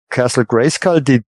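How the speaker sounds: ordinary talk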